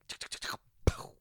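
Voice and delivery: unvoiced-style rapid tuks, then a pop; mouth-made crackle ending in a little burst; imitated popping firecrackers. Imitating the goat transformation sound effect, popping firecrackers. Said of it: "small firecrackers that pop at the end".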